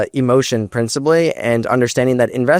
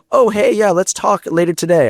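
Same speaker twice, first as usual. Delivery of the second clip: higher, breezy imitation of an investor; mock casual investor; 7 semitones higher than usual. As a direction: toss it off lightly in a raised mimicking voice. Acting out the investor's casual reply.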